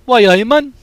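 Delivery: high, loud Newcastle Geordie; hearty Geordie giant greeting; Geordie accent. Shown as a demonstration. Chat asks for BFG, he does loud Geordie. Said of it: "Do B F G's accent?".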